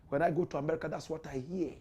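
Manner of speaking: ordinary talk, nothing marked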